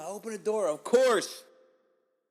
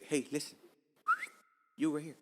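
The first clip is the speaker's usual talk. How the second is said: usual speaking voice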